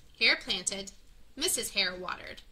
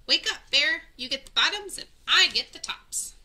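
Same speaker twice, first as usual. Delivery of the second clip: higher and louder wake-up call; 6 semitones higher, 6 dB louder than usual. A narrator's reading. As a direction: shout to rouse a sleeping bear. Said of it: "Hare called out".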